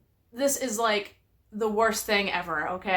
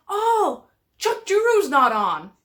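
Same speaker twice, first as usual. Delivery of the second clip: higher, slower mock husband, sure of himself; imitating husband; 7 semitones higher, 4 dB louder, slower than usual. Wife retells husband naming the wrong TV host, high and slow. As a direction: lightly play the husband's confident mistake.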